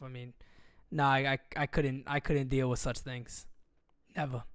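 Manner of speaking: ordinary talk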